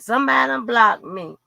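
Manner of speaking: ordinary delivery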